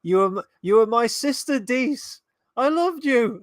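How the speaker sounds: over-the-top villain cry at her sister; mock hateful outburst. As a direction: ham up a villain's betrayed rage. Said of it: "It looks like she's about to go".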